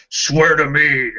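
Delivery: slow mock gravelly "swear to me" growl; gravelly Batman voice. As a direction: drag it out like a gruff hero. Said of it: "would the Spartan be a little bit more".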